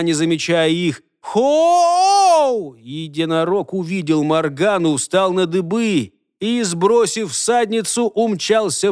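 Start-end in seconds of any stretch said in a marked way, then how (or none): 1.2-2.8 long, high holler; calling out. A narrator's reading. Rider searching from unicorn's back, calling out. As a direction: send out a high, drawn call. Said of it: "звала она".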